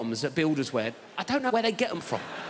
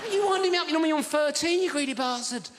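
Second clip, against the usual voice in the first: high-pitched exasperation, easing down; acted, incredulous telling-off; mock indignation; 7 semitones higher than usual. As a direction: have a go at a mate in disbelief.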